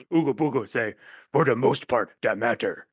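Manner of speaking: low lightly dopey caveman talk. Pretend. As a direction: play a dim-witted caveman. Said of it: "my caveman voice".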